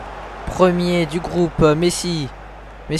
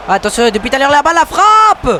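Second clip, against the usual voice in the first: excited sports commentary; 10 semitones higher, 6 dB louder than usual. Acted. Shot in play-by-play.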